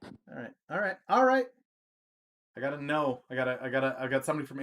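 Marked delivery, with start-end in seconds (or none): 0.0-0.5 softly giving in; quiet concession. Real, not acted. Streamer told to stay in Just Chatting, quietly conceding. 1.1-1.5 high, loud giving in; emphatic concession. Real, not acted. Streamer told to stay in Just Chatting, loudly conceding.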